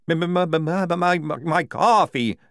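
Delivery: high, loud stutter; agitated stammer. Acted. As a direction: stammer helplessly toward the word. Maledict stammers about stolen coffee.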